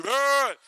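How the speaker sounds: loud blubbering brr; mock big-man sob; big guy's cry. Shown as a demonstration. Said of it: "Big guys do".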